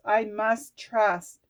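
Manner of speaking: each word slow and heavy, "I must trust" pressed hard; "I must trust" spoken very slow, every word weighted; I must trust in capitals. Retold or imitated. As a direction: lean on "I must trust" as if it were written in capitals.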